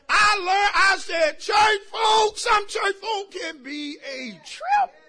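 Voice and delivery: preaching at full force. Acted. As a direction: holler it to the pews. Shouted preaching to church.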